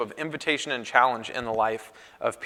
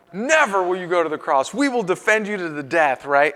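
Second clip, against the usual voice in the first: fired up; 3 semitones higher than usual. Retold or imitated. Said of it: "He's all fired up".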